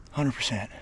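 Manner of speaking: ordinary talk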